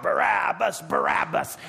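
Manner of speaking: loud, high chanted shout; crowd shouting. Acted. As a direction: roar it like a mob demanding a prisoner. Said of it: "then it grew to a shout".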